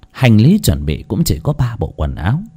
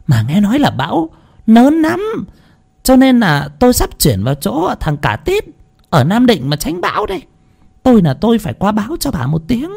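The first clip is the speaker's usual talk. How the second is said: high-pitched, insistent haranguing; exasperated scolding yell; 12 semitones higher than usual. A narrator's reading. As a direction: lose patience with an old woman who won't understand. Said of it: "ngán ngẩm mà gào lên như quát".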